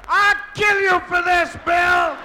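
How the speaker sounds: high, loud threat; wife's furious shout. Retold or imitated. Wife in labor threatens her husband from next door, high furious shout. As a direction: shout it in full labor rage. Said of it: "she's singing a different tune".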